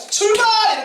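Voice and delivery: high, loud cry of 출발 to the youth; rallying shout. Shown as a demonstration. Pastor rousing the youth group to set out, loud rallying shout. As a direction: bellow 출발 like a bugle call to march. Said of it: "이렇게".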